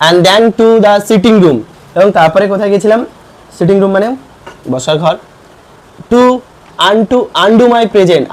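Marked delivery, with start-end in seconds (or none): 0.1-1.6 slow, loud English read for the class; slow classroom reading. Shown as a demonstration. Teacher reads English text aloud. 6.1-8.2 slow, loud English read for the class; slow classroom reading. Shown as a demonstration. Teacher reads English text aloud.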